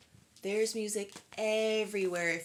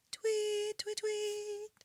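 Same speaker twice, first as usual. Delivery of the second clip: high birdlike tweets; 12 semitones higher, much slower than usual. Acted. Imitating birds in the story.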